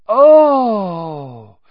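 high, loud frightened squeal; startled shriek at the sight. A narrator's reading. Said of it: "squealed Lavender Brown".

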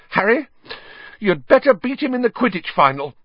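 high, urgent demand; shrill. Acted. Hermione demands Harry beat Malfoy at Quidditch, shrill. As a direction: order it shrilly, pitched right up. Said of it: "Hermione said shrilly".

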